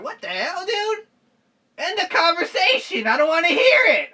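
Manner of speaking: high, loud disgusted imitation; mock outraged friends. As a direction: ham up friends refusing to listen. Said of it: "they're like".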